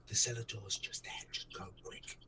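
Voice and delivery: hushed, urgent whisper; giant whispering. Acted. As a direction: whisper it to hidden friends. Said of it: "he sort of backs you up".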